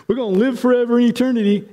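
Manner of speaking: high, quick mock recital; congregation chiming in. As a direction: rattle it off high like believers all agreeing. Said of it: "and we all say".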